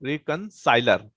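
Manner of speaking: "रिकंसाइलर" slowly, split into its parts; slow, broken-up term. Shown as a demonstration. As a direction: stretch "रिकंसाइलर" out piece by piece, as if dictating. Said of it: "इसको बोलते हैं". ("रिकंसाइलर" धीरे-धीरे, टुकड़ों में तोड़कर)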